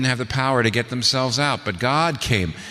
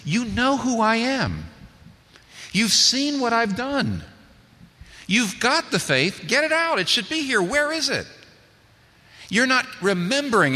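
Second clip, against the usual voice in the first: Jesus urging; 12 semitones higher than usual. Acted. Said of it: "He's saying".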